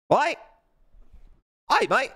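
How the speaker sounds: high, loud mock Aussie accent; mock Australian accent. Streamer asking chat for approval, in a mock Australian accent. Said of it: "this guy's talking in Australian here".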